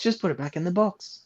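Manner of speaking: normal talking voice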